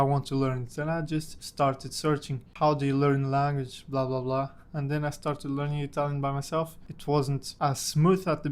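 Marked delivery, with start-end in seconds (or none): none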